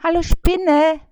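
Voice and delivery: high, loud fly's call. A narrator's reading. Fly Bissi calls the spider, high and loud. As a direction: shout up to the spider, shrill and small. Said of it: "rief Bissi".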